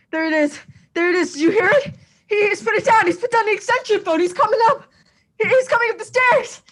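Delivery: terrified cry for the police; rising panic. Acted. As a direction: panic as footsteps come up the stairs. Intruder coming up the stairs.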